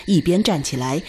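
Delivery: normal talking voice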